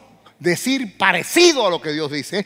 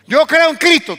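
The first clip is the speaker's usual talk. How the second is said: loud, high, driving sermon peak; fiery preaching; 6 semitones higher, 10 dB louder than usual. Acted. Preacher booming a point to his congregation. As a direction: preach it out full force to the church.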